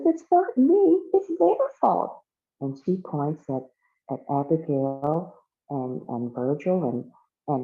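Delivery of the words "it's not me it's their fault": very high, loud whiny blame-shifting; defensive protest. Acted. Accused girl blames the others, high loud protest. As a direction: squeal it defensively, pitched way up.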